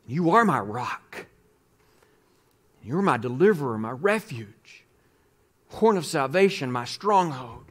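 hushed worship prayer from the pulpit; quiet prayer. Acted. Speaker prays aloud between teaching.

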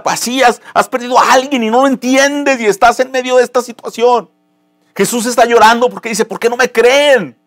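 high, loud impassioned preaching; preaching at full force. Acted. Pastor preaching to his church, impassioned and loud. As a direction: lift the congregation, pleading and loud.

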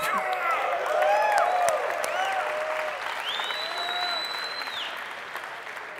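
a long, high, gleeful laugh; high triumphant laugh. Real, not acted. The speaker, celebrating his first sale before the audience, laughs high and long. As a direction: laugh out the victory.

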